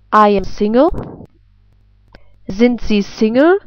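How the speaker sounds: ordinary talk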